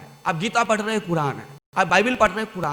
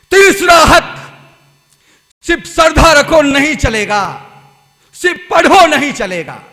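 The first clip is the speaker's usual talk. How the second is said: loud, driving sermon voice; fervent preaching; 9 semitones higher, 17 dB louder than usual. Acted. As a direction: preach it out to the crowd. Preacher exhorts loudly.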